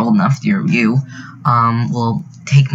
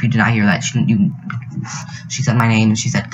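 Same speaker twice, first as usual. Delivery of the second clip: ordinary talk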